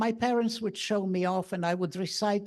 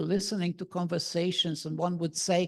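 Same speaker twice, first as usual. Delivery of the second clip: ordinary delivery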